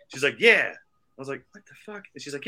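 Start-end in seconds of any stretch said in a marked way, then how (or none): 0.4-0.7 high, loud matter-of-fact yes; imitated woman. Woman confirming she works at the hotel, high loud answer. 1.5-2.0 high, hushed disbelief; stunned aside. Retold or imitated. Learning she works there, hushed high-pitched disbelief.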